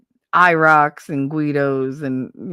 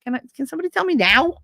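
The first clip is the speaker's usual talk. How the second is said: high, raised-voice demand; mock demanding outburst; 11 semitones higher, 4 dB louder, much faster than usual. Storyteller acting out her own exasperated demand, high mock outburst. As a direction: demand an answer, pitch jumping up high.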